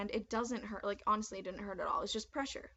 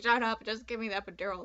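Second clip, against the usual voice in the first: impatient; 6 dB louder than usual. Retold or imitated. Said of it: "I was like".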